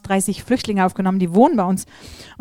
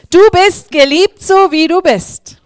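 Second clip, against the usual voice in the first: emphatic assurance; 10 semitones higher, 12 dB louder, slower than usual. Real, not acted. Preacher's emphatic reassurance.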